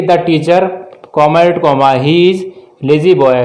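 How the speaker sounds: very slow dictated sentence; slow dictation. Shown as a demonstration. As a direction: read the example slowly for copying. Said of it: "teacher dictating example sentence". (धीरे-धीरे वाक्य लिखवाना)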